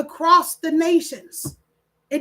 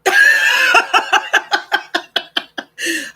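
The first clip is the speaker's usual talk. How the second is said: high, loud burst of laughter at herself; 11 semitones higher, 6 dB louder than usual. Real, not acted. Host laughing at her own slip on stream, loud and high. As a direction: crack up laughing.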